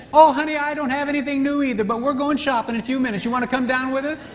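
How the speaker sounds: put-on high, gushing girlfriend voice; chatty women friends. Women inviting a friend shopping, high and gushing.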